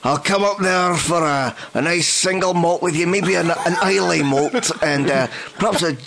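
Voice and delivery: hammed-up Scottish accent, slow and laughing. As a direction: toast the Scottish lads with a mock Scottish brogue. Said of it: "in your Scottish accent".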